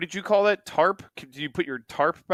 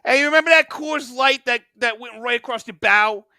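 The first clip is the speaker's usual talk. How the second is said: hammed-up New Jersey accent, higher; cocky New Jersey heckler; bragging Jersey fan in a New Jersey accent; 6 semitones higher than usual. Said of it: "that voice".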